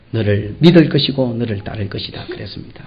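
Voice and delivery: ordinary talk, nothing marked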